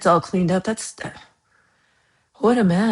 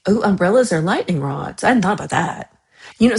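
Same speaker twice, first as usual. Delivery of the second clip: ordinary talk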